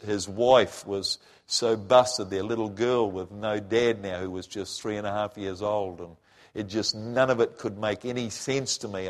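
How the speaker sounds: ordinary delivery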